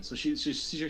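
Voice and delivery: ordinary delivery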